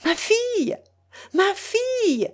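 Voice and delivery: high-pitched excited call; calling out from afar. A narrator's reading. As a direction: call to your daughter across a distance. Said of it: "s'écria madame Hamel, d'aussi loin".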